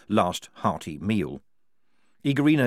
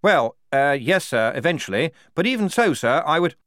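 flustered lieutenant; 7 semitones higher, 5 dB louder than usual. A narrator's reading. Blouse stammers before the Major.

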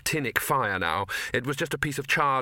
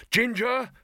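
higher, louder call across the cavern; 11 semitones higher, 4 dB louder than usual. Acted. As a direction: shout her name to stop her. Calling out in the cavern.

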